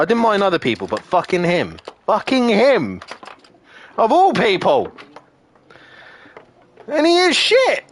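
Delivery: real anger building word by word; angry rant. Real, not acted. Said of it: "I'm just angry".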